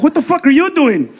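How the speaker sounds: loud, high alarmed question; boy shouting at his brother in alarm. Retold or imitated. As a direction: shout it in disbelief. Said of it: "I'm like".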